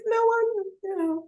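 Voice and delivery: put-on very high, small child's voice; young child answering. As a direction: imitate a little kid's forlorn reply. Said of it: "happen with my child".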